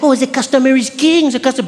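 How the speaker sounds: high-pitched French-accented mockery; mock servile. Frenchwoman mocking American service, mock servile. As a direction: fawn with exaggerated reverence.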